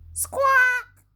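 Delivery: loud, very high squawk; high hen squawk. Acted. Golden hen squawking at Jack, high and loud.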